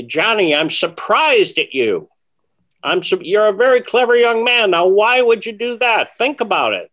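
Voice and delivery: an old mentor voiced high and loud; imitating Di Vernon. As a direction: chide him like a disappointed teacher. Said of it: "He would say something like".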